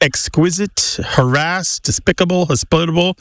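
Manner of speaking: "exquisite, harassed, despicable, hospitable" each stressed on the second syllable. Shown as a demonstration. Host listing shifted words, "exquisite, harassed, despicable, hospitable" stressed on second syllables. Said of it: "that stress in the pronunciation to the second syllable".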